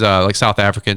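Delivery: ordinary delivery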